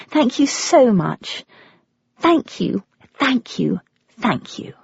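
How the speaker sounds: grateful, trailing off breathlessly; fading thanks. A narrator's reading. Caller thanks Judy, fading to a whisper.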